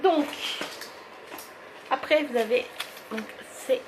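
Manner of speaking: normal talking voice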